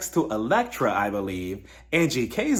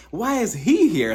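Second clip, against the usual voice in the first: shocked; 6 semitones higher, 3 dB louder, slower than usual. Retold or imitated. Jared walks through the door.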